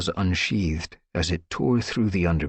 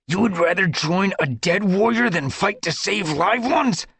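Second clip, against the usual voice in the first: high, loud snarled rebuke; 13 semitones higher, 4 dB louder than usual. Acted. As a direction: snarl it into his face. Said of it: "snarled into Crowpaw's face".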